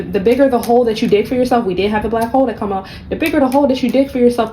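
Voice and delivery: ordinary talk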